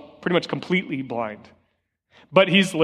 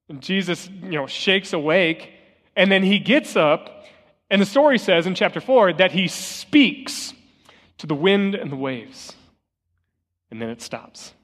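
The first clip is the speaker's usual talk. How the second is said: ordinary talk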